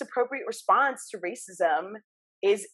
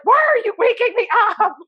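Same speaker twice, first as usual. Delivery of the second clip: put-on much higher whiny child voice with laughter; a child protesting being woken from a nap; cranky woken child; 13 semitones higher than usual. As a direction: laugh while whining like a woken kid. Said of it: "I'd be like".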